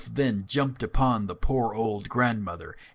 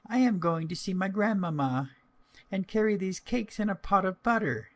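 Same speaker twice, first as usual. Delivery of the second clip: put-on higher, light little girl voice; 9 semitones higher than usual. Girl telling the wolf her errand, high child voice. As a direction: speak up like a small girl.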